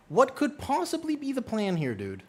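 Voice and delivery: high, loud incredulous question; exasperated disbelief. Real, not acted. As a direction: demand it of a misbehaving pet, loud and high. Incredulous at cat on windowsill.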